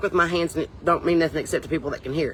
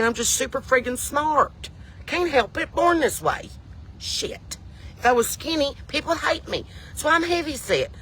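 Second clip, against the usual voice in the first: mock boasting; 9 semitones higher than usual. Mock boastful self-defence rant.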